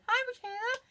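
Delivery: high falsetto imitation of a giddy mother; sing-song high mom greeting; ditzy mom voice. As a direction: chirp it way up like a silly mom. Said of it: "came up to her and she went".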